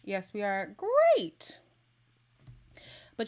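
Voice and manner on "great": high, loud squeal of relief; excited. Real, not acted. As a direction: cheer that the stream is back. Stream back after buffering.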